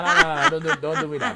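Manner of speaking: high laughing nah nah nah, loud; laughing protest. Real, not acted. Teased by co-host about his smile, laughing protest.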